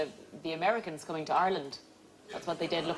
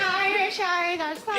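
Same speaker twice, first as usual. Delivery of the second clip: creepy doll voice; 12 semitones higher, slower than usual. Pretend. Panellist imitating a creepy talking leprechaun doll. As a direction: mimic a possessed talking doll. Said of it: "Is it like a Chucky doll".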